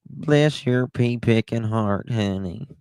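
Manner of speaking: syrupy Southern sweetness. Acted. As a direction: stretch it out in playful Southern style. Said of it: "us Southern people are like".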